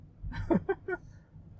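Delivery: high unvoiced laugh. Real, not acted. Amused by the confused lady.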